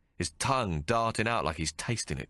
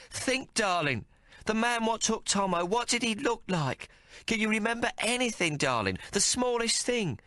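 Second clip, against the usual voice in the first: high, urgent coaxing; urgent mother; 13 semitones higher than usual. Acted. Mary shaking her son, urging him to remember, high. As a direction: press him gently but urgently, pitched high. Mary's voice held.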